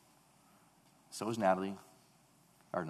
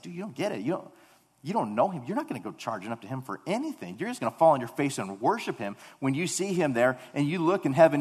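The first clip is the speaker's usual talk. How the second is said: fervent rebuke; 5 semitones higher, 7 dB louder than usual. Retold or imitated. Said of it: "I just think, like".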